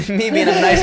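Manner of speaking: laughing. Real, not acted. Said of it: "laughing at himself".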